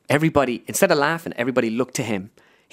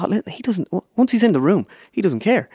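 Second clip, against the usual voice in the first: eager reassurance; 9 semitones higher than usual. Retold or imitated. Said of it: "I was like".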